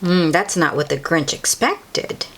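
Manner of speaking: ordinary delivery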